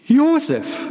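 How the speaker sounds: very high, loud fearful call; woman lost in the dark calling her husband; anxious call. A narrator's reading. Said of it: "rief da irgendwo angstvoll".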